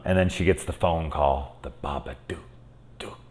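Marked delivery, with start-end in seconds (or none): 1.6-3.2 lightly imitated movie monster, low-key; a hint of a creepy creature voice; Babadook voice. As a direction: drop the level and space out each dook. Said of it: "and that voice".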